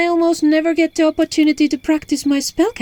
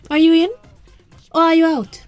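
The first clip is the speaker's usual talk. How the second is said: higher, punchy dare; pressing challenge; 6 semitones higher, 5 dB louder, faster than usual. Acted. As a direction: put them on the spot. Daring the doubter to join.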